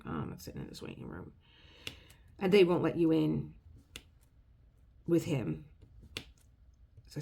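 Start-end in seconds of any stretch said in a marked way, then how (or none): 0.0-1.3 mock whisper. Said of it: "I was like".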